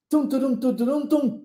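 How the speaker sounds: vocal drum roll; rhythmic tum-turum sounds; imitated drum beat. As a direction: tap out a lead-in rhythm like a drummer. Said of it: "o cara lá da bateria faz tum".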